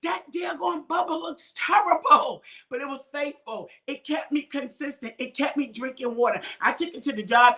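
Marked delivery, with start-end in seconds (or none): 0.0-2.4 loud, higher mock jeer from gossiping people; hammed-up scornful bystander; mocking onlookers. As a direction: people at the job making fun of her old mug, in a mock jeer. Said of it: "People would be like".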